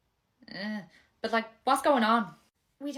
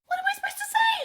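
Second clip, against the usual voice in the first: very high-pitched panic; high, desperate protest; panicked; 4 dB louder, much faster than usual. Acted. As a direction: squeal the question helplessly. Panicked, asked to pretend.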